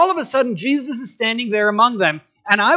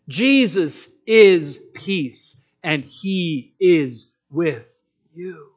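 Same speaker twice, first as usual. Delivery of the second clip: slow emphatic; 4 semitones lower, much slower than usual. Real, not acted. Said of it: "emphatic slow restatement".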